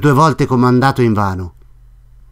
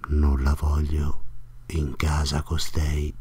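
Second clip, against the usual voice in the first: whispered private thought, much softer; inner thought; 8 semitones lower, 10 dB quieter, slower than usual. A narrator's reading. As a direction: hush it as if thinking to himself. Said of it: "pensava intanto l'innominato".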